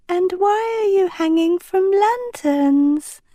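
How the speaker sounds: a cheerful princess passing on news; Uma's voice. Acted. Uma's floating face telling the crew, light princess voice.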